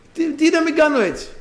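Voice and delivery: very high acted protest; indignant retort. Blind man refusing to be hushed, indignant retort. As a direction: throw the question back, pitched far up.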